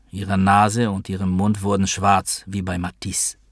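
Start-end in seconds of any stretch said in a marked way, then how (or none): none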